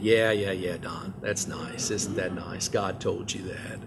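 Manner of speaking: low, soft mock-indulgent dismissal; sarcastic brush-off. Church board humoring the young pastor, softly sarcastic. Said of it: "being a little sarcastic there".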